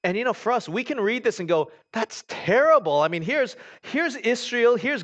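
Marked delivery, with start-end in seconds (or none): 1.9-3.0 high, loud, slow indignation; mock outrage. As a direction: act scandalized.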